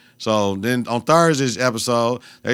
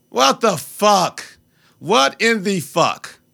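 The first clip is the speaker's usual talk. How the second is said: slow disbelief; 7 semitones higher, much slower than usual. Real, not acted. Drawn-out disbelief.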